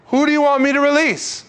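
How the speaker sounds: high, loud question called out to a crowd. Retold or imitated. Pilate asks the crowd to choose a prisoner, high loud demand. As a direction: call it out high, demanding an answer.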